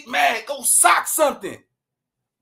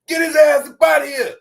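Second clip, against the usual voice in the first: fast, gruff shout of an annoyed grandpa; grandpa yelling; 4 semitones higher, 7 dB louder than usual. Retold or imitated. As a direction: bark it from the couch, annoyed at the kid.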